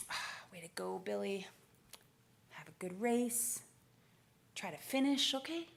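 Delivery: soft, halting mock well-wishing; half-hearted encouragement. American contingent to Billy before the final, half-hearted encouragement. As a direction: trail out a feeble pep talk. Teammates with no expectations, retold.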